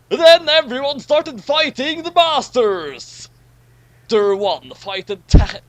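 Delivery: loud, high, bursting with excitement; building the fight scene up big; excited. Acted. Narrator excitedly announcing the big battle. Dramatic story climax.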